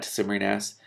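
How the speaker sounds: ordinary delivery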